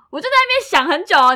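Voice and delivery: ordinary talk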